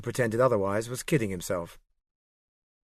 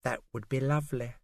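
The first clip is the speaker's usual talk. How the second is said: a light, softened, playful purr, lightly voiced; a secretary melting a little at a lunch invitation, kittenish; 2 semitones higher, 5 dB quieter than usual. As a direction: flirt back, suddenly pleased. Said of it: "suddenly kittenish".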